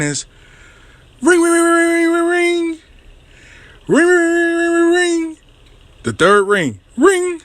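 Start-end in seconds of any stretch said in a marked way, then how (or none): 1.2-5.4 high-pitched mimicked ringing tone; phone ring imitation. 7.0-7.4 one high falsetto ring; phone ring imitation.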